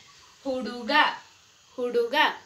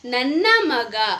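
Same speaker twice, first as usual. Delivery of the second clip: slow careful model; 2 semitones lower, 4 dB louder, slower than usual. Shown as a demonstration. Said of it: "तो इसको हम कन्नड़ा में बोलेंगे".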